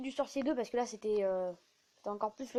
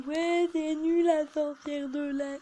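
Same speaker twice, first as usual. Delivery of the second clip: mocking complaint; 4 semitones higher, 4 dB louder, much slower than usual. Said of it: "C'est juste que on dit".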